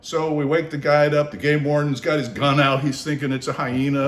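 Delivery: ordinary talk, nothing marked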